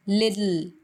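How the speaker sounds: "लिडल", t softened to d; flapped t "little". Shown as a demonstration. Teacher showing the native little. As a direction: say "little" as "liddle". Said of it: "लिटिल को बोलेंगे लिडल".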